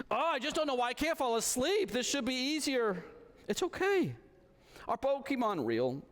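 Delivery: put-on a child confessing fear at bedtime; little daughter. Daughter voiced in bedtime story.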